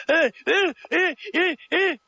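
high yelps, jolted with each shake; shaken yelps. A narrator's reading. Xia Xi being shaken by He Long, yelping high. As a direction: cry out as you're jerked about.

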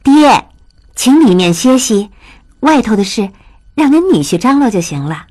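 略微提高音调的笑盈盈女儿声; 亲热地招呼父亲进屋歇息; 笑呵呵的女儿. A narrator's reading. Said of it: "玉汉笑呵呵上前迎着陆大可说". (女儿笑着劝父亲进屋，语气亲热)

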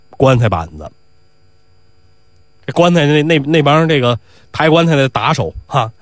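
ordinary delivery, nothing marked

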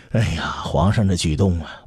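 plain reading voice

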